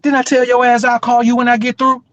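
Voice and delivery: mock woman scolding her man; angry girlfriend. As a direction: snap at him like his angry girlfriend. Girlfriend yelling on speakerphone.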